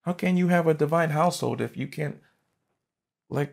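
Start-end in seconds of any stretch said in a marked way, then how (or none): none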